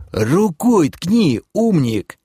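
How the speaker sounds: high, slow, exasperated order; nearly yelling. Acted. Ordering the clever elf to point, barely not yelling. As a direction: strain to hold back a shout. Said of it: "едва не сорвался я на крик".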